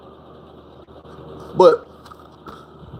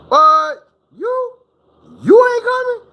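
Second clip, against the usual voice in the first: much higher, squeaky disbelief; shocked disbelief; 5 dB louder, slower than usual. Retold or imitated. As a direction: coworkers shocked he won't come outside, high squeal. Coworkers shocked he declines.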